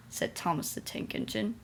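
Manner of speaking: usual speaking voice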